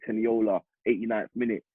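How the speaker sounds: ordinary talk, nothing marked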